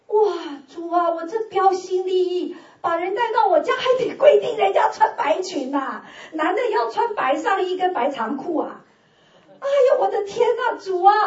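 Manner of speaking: high, loud exasperated wail to the Lord; exasperated cry. Retold or imitated. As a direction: protest to God in disbelief. Exasperated retold prayer.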